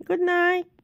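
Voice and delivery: high, loud good-night call; insistent high bedtime call; sending to bed. Real, not acted. Sending the sleeper back to sleep.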